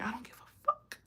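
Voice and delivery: unvoiced whisper with a rising end; retold whisper. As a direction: hiss it out in a whisper. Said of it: "And I was like".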